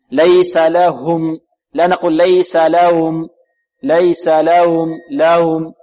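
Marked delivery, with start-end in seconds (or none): 0.1-1.4 ليس لهم slow, with the ha of لهم breathed out clearly. Shown as a demonstration. Teacher showing the clear ha in ليس لهم. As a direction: make the ha in لهم distinct. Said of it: "لابد من إيضاح الهاء".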